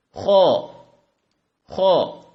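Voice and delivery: خا with a rasping throat sound; Arabic letter kha. Shown as a demonstration. Teacher demonstrating خا to viewers, loud and clear. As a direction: scrape خا from the back of the throat. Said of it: "آپ یہ آواز نکالیں گے".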